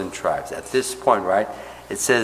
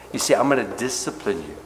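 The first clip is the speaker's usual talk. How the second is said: ordinary talk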